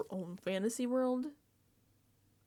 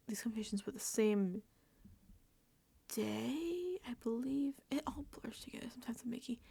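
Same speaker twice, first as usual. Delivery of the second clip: whispered aside; 7 dB quieter than usual. Real, not acted. Aside about timeline.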